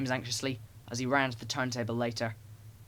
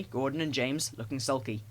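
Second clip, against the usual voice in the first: ordinary delivery, nothing marked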